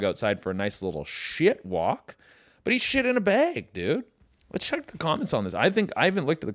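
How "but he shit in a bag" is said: incredulous. Real, not acted. Amazed reaction to the dog clip.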